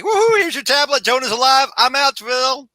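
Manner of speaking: high, bright mock hero goodbye; hammed-up Spider-Man exit; giddy Spider-Man. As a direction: wave it off cheekily. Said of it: "He's like".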